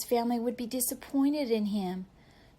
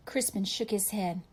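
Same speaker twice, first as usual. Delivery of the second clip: ordinary delivery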